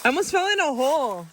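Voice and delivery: dismayed at a lost swing; shocked dismay. Real, not acted. Finds the swing taken down.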